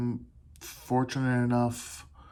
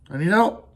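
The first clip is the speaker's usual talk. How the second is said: "I need help." said higher and louder with a rising end; plain admission, stressed; 4 semitones higher, 6 dB louder than usual. Shown as a demonstration. Showing listeners how to ask for help, open and raised. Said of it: "Let people know you need help".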